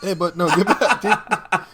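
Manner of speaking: high laughing pet-talk; scolding the cat. Real, not acted. Host scolding his cat in a high laughing voice.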